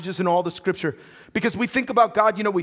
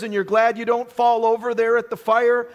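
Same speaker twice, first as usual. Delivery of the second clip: normal talking voice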